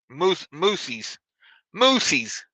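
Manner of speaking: moosies said as moose plus a long ee ending, musiz; joke plural. Shown as a demonstration. Trucker joking about moose plurals, saying moosies as a mock plural. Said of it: "That's how you say it".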